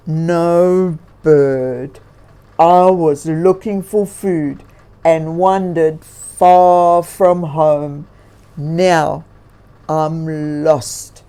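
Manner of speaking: a weary bear admitting he's lost; defeated bear. A narrator's reading. Lost bear answering the bird, lightly voiced and defeated. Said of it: "Grizzly admitted in defeat".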